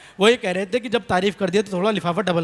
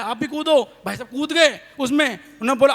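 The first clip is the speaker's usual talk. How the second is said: ordinary talk, nothing marked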